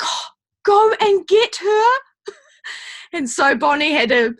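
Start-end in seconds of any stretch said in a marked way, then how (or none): 0.0-1.8 a high, excited mock coo of a delighted grandmother; high imitating coo. Imitating the mentor calling her to bring her daughter, high and delighted. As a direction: squeal it like a thrilled granny. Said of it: "you're like".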